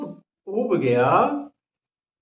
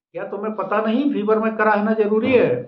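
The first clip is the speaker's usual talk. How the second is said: higher, louder and fast; mock indignant outburst; 5 semitones higher, 5 dB louder, faster than usual. Husband scolds his wife in mock outrage, loud and fast. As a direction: fire it off in comic outrage.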